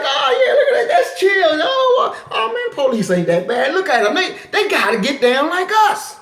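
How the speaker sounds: high, giddy mock voice of a naive fan; laughing mock voice of a fooled viewer; naive cop fan. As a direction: squeal like someone charmed by dancing cops. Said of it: "And you be like".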